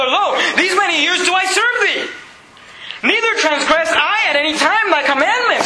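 mock the son's self-righteous complaint; indignant elder son.

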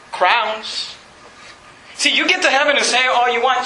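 ordinary talk, nothing marked

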